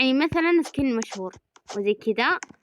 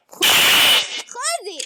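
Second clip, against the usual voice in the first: high call; 14 semitones higher, 5 dB louder than usual. Acted. Gamer calling the character, high pitched. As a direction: float the name up high.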